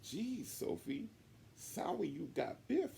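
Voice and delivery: put-on high, slow girlish voice; sweet tagalong kid, drawn out; a high little girl; little Allie voice.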